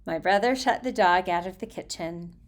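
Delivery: plain reading voice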